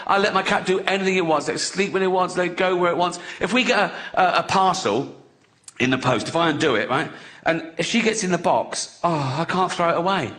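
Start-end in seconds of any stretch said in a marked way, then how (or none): none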